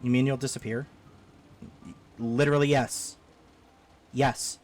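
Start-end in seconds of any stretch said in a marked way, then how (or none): none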